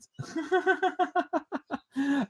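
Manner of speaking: long, high giggle; real, drawn-out high laughter; high-pitched laugh. Real, not acted. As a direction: laugh high at your own waffling. Laughs at own waffling.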